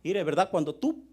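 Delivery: ordinary talk, nothing marked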